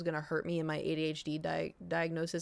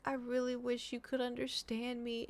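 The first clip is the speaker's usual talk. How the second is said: higher, soft, near tears; tearful plea; 5 semitones higher, 6 dB quieter than usual. Real, not acted. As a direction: wish it aloud, voice breaking. Pleading to cat, tears coming.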